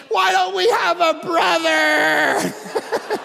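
high, loud, slow laugh-cry; exasperated laughing whine. Retold or imitated. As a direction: wail it while cracking up. Daughter exasperated.

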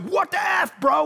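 high, shouted "what the F"; mock yell. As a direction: snap at him like a teen in a fight. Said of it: "I was like".

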